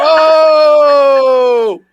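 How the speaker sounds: loud, high "Oh!" of mock outrage; shocked shout. Real, not acted. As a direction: yelp in shocked disbelief. Reacts to wench joke.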